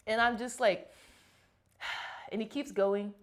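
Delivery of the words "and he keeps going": normal talking voice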